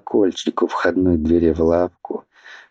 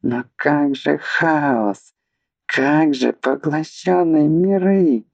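high, slow, squealing despair; helpless squeal; 8 semitones higher, slower than usual. Acted. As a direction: shriek out in helpless panic. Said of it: "заверещал в бессилии Марквин".

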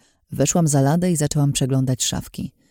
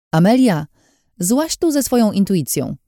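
calling up from below; 6 semitones higher, 3 dB louder than usual. Acted. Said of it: "rozległo się wołanie z dołu".

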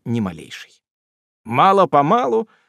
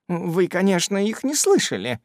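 bashful; 5 semitones higher than usual. A narrator's reading. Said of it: "стыдливо проговорила она".

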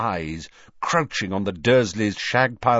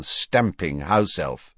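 plain reading voice

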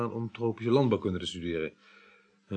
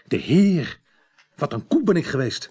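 sudden realisation; 6 semitones higher, 5 dB louder than usual. Acted.

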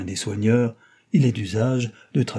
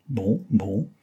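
ordinary delivery

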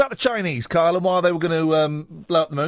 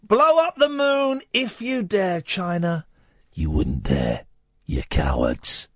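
mock taunting; 2 semitones lower, slower than usual. Host calling China out on air, mock menace.

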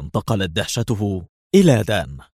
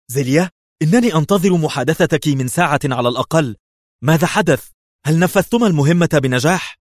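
higher, louder, impatient; eager; 7 semitones higher, 4 dB louder than usual. A narrator's reading. The ambassador on the phone to his agent, eager. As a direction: press her eagerly for news. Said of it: "بدت اللهفة في صوته".

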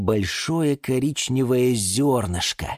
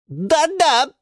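high, loud agreement; eagerly chiming in; 5 dB louder than usual. Acted. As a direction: jump in to back it up. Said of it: "подхватили фикусы". (подхватывая)

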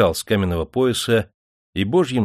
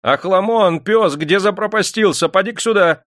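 loud, high-pitched summoning yell; shouting across the garden; 10 semitones higher, 5 dB louder than usual. A narrator's reading. Master calls his bodyguard across the garden, shouting. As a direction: bellow for the servant to come at once. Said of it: "закричал на весь сад".

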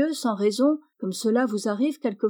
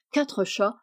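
ordinary delivery

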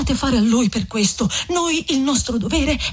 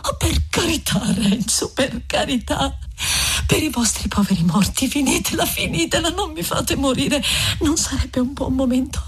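Lucia pleading. Acted. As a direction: beg him gently with a pitched-up voice. Lucia begs Renzo.

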